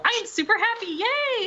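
very high, bubbly imitation; super happy. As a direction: squeal it like a little ray of sunshine. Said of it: "such a bright re-, little ray of sunshine".

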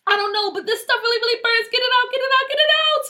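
high, frantic pleading that climbs; screamed panic. A narrator's reading. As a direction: beg desperately for the burning to stop. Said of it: "Tia screamed louder".

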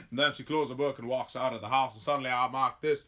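folksy storyteller with a put-on Southern accent; Forrest Gump. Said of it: "I'm not trying to become Forrest Gump".

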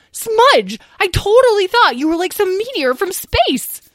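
excited chatter. A narrator's reading. Lyle excitedly recounting.

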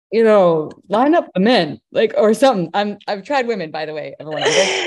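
excited outburst. Real, not acted. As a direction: let it burst out with giddy glee. Excited, laughing rant about men.

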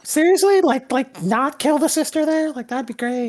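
high sarcastic mock whine. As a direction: whine it back mockingly. GM mocking in high voice.

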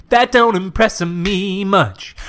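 slow, drawn-out lyric quote. Retold or imitated. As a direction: stretch every word out. Quoting the song lyric.

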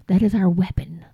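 hushed, breathy emphasis; whisper. Real, not acted. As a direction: whisper it like a secret truth.

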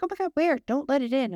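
high-pitched panicked shriek of a mom seeing a mouse. Retold or imitated. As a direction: squeal it like a mom terrified of mice. Mom panics about mouse in house.

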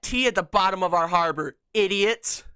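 loud scornful idiots; mocking yell. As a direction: spit it out as a jeering insult. Mocking shout at the Brits.

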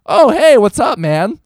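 high, loud, breezy hey; casual friendly greeting. Retold or imitated. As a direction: greet a friend on the street, upbeat. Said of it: "it's kind of on you to be like".

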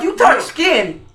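normal talking voice

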